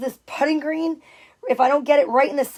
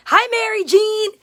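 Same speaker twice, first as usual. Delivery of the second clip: high, loud hello to a friend; bright greeting; 8 semitones higher, 4 dB louder than usual. Real, not acted. Greeting a viewer who just joined the live stream, high and bright. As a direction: call out a happy hi.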